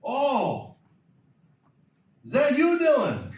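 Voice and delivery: the woken dad suddenly realizing who it is; surprised dad. Retold or imitated. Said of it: "He's like".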